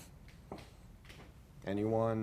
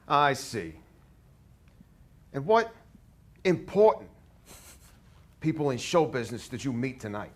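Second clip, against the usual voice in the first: put-on loud, higher, hammy New York accent; over-the-top New York accent; 3 semitones higher, 6 dB louder than usual. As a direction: go as big as you can on New York. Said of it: "over-the-top New York we could possibly do".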